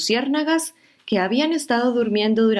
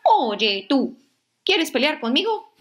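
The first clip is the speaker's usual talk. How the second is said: higher, grumpy little bug voice; grouchy ladybug; 8 semitones higher than usual. Acted. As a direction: taunt it like a cranky ladybug. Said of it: "la mariquita malhumorada le dijo".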